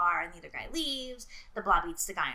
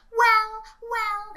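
snooty customer voice; 12 semitones higher, 12 dB louder than usual. Retold or imitated. Mocking difficult customers.

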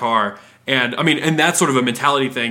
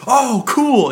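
much higher, louder delight; excited customer; 11 semitones higher, 4 dB louder than usual. Retold or imitated. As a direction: squeal with joy.

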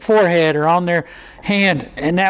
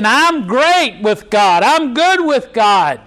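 smug self-satisfaction; 4 semitones higher than usual. Retold or imitated. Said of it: "they're thinking".

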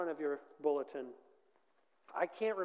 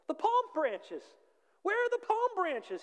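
very high, loud theatrical shout; 4 dB louder, slower than usual. A narrator's reading. Donkey demanding palm branches at the market, shouted. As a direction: shout it in hammed-up indignation. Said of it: "he shouted".

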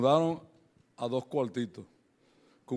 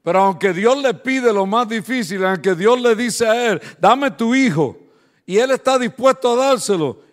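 loud, high, building preacher cry; preaching at full force; 8 semitones higher, 12 dB louder than usual. Acted. Pastor to the congregation about his children, preaching at full force. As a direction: pour out the hurt his children bore.

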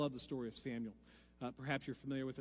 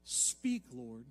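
much softer and lower; hushed prayer; 4 semitones lower, 4 dB quieter than usual. Acted. Preacher softly repeating Samuel's humble answer. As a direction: breathe it like a quiet prayer.

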